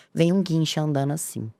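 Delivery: normal talking voice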